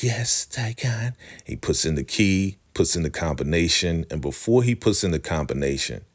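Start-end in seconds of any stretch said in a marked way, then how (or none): 0.0-1.1 high, slow dainty woman's voice; mock sweet woman. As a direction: coo his name like a demure lady. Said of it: "Joan is like".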